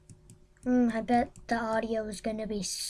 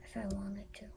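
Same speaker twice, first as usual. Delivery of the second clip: whisper; 3 semitones lower, 14 dB quieter, faster than usual. Real, not acted. Whispered aside.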